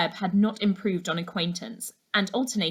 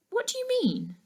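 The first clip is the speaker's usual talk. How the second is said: put-on young girl asking. A narrator's reading. Laura asks, curious.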